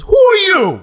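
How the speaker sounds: mock her clipped suspicion; high sharp woman's voice. Phone woman demanding who's calling. Woman answering at Rainbow Coalition.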